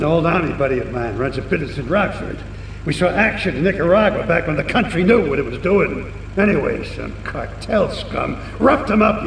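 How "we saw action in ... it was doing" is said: ordinary delivery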